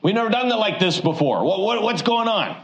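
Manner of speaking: high, flustered sputter; lightly hammed confused disciples. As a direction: stammer like rattled followers. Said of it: "a little bit of shock".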